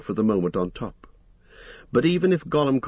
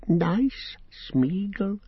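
much higher, slow cooing creature; creepy self-praise; 10 semitones higher, much slower than usual. A narrator's reading. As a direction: coo your own name like a pet. Gollum praising himself.

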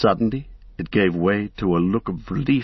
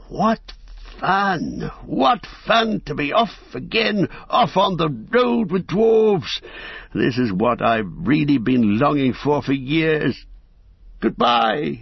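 high, delighted hobbit voice; bursting with joy to be off; excited hobbit; 7 semitones higher, slower than usual. Acted. Bilbo excited to leave.

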